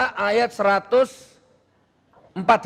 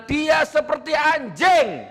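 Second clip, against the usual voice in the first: high, drawn-out forceful declaration; keras; 8 semitones higher, slower than usual. Shown as a demonstration. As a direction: hurl it out hard and high. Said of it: "Lu kok keras". (suara tinggi dan keras)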